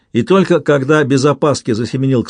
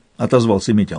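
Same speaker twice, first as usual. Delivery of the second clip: plain reading voice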